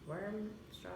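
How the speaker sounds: talking round sour candy; words through a mouthful of candy; mouth full. Real, not acted. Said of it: "way too much sour in one".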